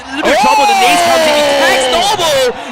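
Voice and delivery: loud hyped play-by-play call; esports caster. Real, not acted. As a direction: scream the clutch like a caster. Caster calls clutch grenade knock.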